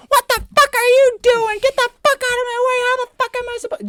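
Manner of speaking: high, shrill-pitched mock rant; hammed-up furious lady; mimicking angry driver. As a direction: impersonate a raging lady driver, fast at the end. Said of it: "she goes".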